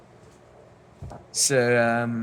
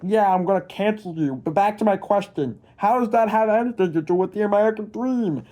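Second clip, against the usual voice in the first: silly, higher and louder put-on complainer; mocking viewer voice; 6 semitones higher, 4 dB louder than usual. Imagined viewer threatening to cancel the vlogger, in a silly mocking voice. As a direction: play the pushy viewer in a mock voice. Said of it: "Yeah, I actually talk like that".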